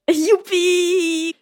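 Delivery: high, sarcastic hooray; sarcastic cheer. Real, not acted. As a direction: fake delight. Ironic cheer about constant periods.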